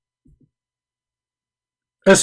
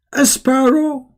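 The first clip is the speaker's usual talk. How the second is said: incredulous; 8 semitones higher than usual. Real, not acted. Incredulous mocking question.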